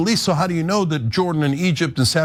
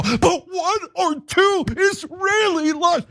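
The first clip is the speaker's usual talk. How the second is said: much higher, slow, shouted sarcasm; sarcastic mock; slower than usual. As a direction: echo it with scorn, stretched out. Sarcastic echo of quote.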